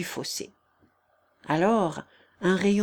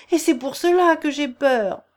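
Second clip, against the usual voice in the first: higher and louder, frightened; fearful cry; 6 semitones higher, 6 dB louder than usual. Acted. Agnelette admits to Thibault that she fears him. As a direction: blurt out the fear.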